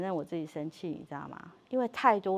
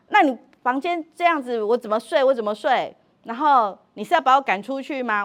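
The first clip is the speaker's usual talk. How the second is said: louder, higher angry retort; angry husband; 4 semitones higher, 8 dB louder than usual. Retold or imitated. Husband storming at his wife over the messy room, angrily loud. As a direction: throw the complaint back loudly. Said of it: "他就生气咯".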